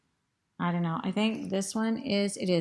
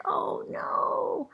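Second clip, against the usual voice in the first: put-on very high little-girl wail; mock a small girl's dismay, high-pitched; imitated dismay. Reader imitating a little girl watching, high dismay.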